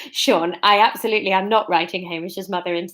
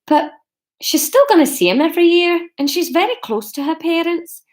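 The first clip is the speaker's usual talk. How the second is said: put-on much higher, unsteady Scottish accent; imperfect Scottish accent, pitched up; attempted Scottish accent; 8 semitones higher than usual. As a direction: argue in a rough Scottish accent. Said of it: "distinguishable from the Scottish accent".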